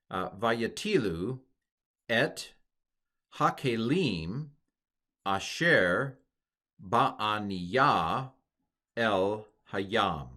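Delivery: slow, syllable by syllable Hebrew, pausing between words; slow demo read of Hebrew; careful Hebrew reading. Shown as a demonstration. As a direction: read it out word by word for students. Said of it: "see if you can pronounce this".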